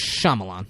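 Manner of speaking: Shyamalan said as "Sha-ma-lon"; correct name. Shown as a demonstration. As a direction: pronounce Shyamalan in three syllables, no extras. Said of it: "say his name correctly".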